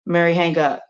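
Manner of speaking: ordinary delivery, nothing marked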